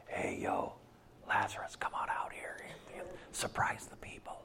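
hushed, casual whisper to Lazarus; mock secret whisper. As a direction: whisper it low, as if keeping it secret. Mock secretive call, contrasted with loud one.

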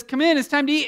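mock mom calling. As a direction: call the kids in for dinner. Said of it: "And your mom says".

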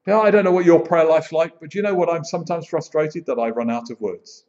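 usual speaking voice

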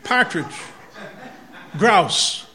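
Boston-style dropped r in partridge; Massachusetts accent. Shown as a demonstration. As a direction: say it like a New Englander. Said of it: "Excuse my Massachusetts accent".

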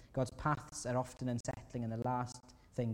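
ordinary talk, nothing marked

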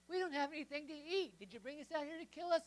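loud, high griping at Moses; whiny complaint. Acted. Israelites griping at Moses in the wilderness, loud whiny complaint. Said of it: "they complain".

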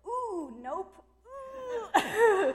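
high loud laughing ooh nope; squealing refusal. Retold or imitated. Refusing the Holy Spirit's nudge, squealing and laughing. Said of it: "And I go".

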